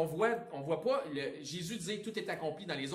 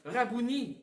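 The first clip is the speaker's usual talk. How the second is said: put-on louder, higher Hebrew accent on Rabouni; 8 semitones higher, 8 dB louder, slower than usual. Preacher quoting Mary, trying a Hebrew accent. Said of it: "excusez mon accent hébraïque".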